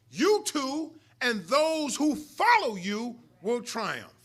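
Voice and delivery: loud, drawn-out "those who follow you"; stress on FOLLOW YOU. Real, not acted. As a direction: preach it slowly, hitting "follow you" hard. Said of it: "Notice I put the emphasis".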